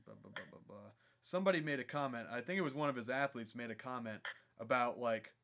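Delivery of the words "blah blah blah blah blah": very soft, low mumble; barely voiced filler while reading to yourself; muttering while skimming. Real, not acted. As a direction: mumble under your breath as you scan text. Scanning comments for a quote.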